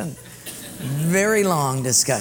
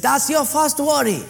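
higher, louder mock Japanese accent boss; broken-English mock Japanese accent, pitched up; Mister Sato; 10 semitones higher, 5 dB louder than usual. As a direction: play the sushi owner in a mock Japanese accent. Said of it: "He said".

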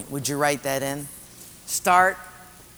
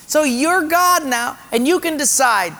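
tempting devil; 10 semitones higher, 6 dB louder than usual. Acted. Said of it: "what the devil was saying".

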